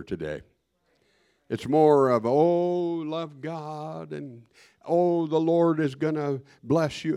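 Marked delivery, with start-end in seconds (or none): 2.3-7.2 lightly mocking, higher-pitched singsong piety; mock feel-good preaching. Mimics popular feel-good preaching.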